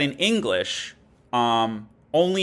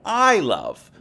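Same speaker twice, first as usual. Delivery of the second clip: "I" said much higher and louder, "love" falling; stress on I; 7 semitones higher, 7 dB louder than usual. Shown as a demonstration. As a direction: hit "I" hard then let "love" drop. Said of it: "that's putting an emphasis on the pronoun".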